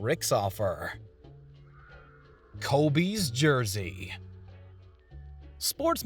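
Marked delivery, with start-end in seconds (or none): 2.6-4.1 top ten countdown. Acted. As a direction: announce the next entry slowly. Countdown item title announced.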